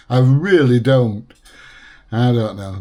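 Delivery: ordinary talk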